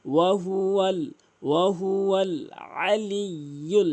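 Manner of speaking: slow melodic chant, ইল্লা lifted; Quran recitation. Shown as a demonstration. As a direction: recite slowly, lifting ইল্লা.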